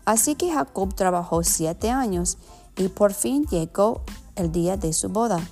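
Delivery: Spanish with an American accent. Real, not acted. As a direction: read Spanish as an English speaker. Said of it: "perdona mi español".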